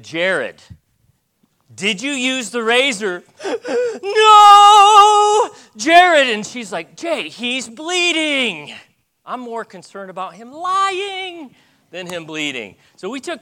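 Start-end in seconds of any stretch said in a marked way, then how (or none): 0.0-3.2 sterner repeat. Retold or imitated. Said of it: "And I said". 3.3-4.0 high sharp gasp of alarm; shocked gasp. Retold or imitated. Mother sees blood. 4.0-6.3 high, loud cry of a panicked mom; alarmed mother shouting. Retold or imitated. Mother alarmed at bleeding son. 7.0-8.7 slow, high, pleading wife's voice; worried wife. Retold or imitated. Wife urging husband.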